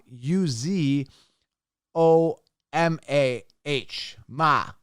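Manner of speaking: usual speaking voice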